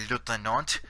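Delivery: "lieutenant" said the French way; French-style "lieutenant", last syllable like "nont"; French pronunciation. Shown as a demonstration. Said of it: "the French pronounce it".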